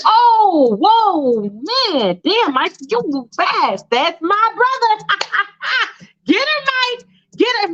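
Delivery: high, swooping, drawn-out cheering; whooping Jermaine. Acted. Jermaine catching Michael with Allison, high gleeful whooping. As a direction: hoot and egg him on with a laugh. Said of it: "And he's like".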